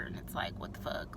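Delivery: whispered, stunned what the fuck; disbelieving whisper. Real, not acted. Venting about being cheated on.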